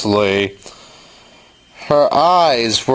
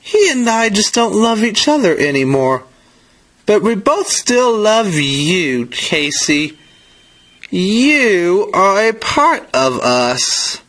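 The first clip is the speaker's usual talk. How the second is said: higher-pitched, earnest mother's explanation; a touch of pleading reassurance for a child; lightly voiced mother; 8 semitones higher than usual. A narrator's reading. Mother explaining the divorce to Casey, lightly voiced reassurance.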